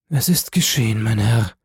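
rasping, croaked dying voice; death rattle. A narrator's reading. Dying wife confesses to her husband, with a rattle in her throat. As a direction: force it out through a rattling throat. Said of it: "sagte sie mit einem Röcheln".

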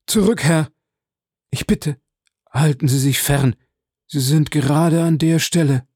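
high-pitched panicked warning; urgent, pleading outcry; desperate cry. A narrator's reading. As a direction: cry out as if you can hold back no longer. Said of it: "vermochte es nicht länger auszuhalten und rief".

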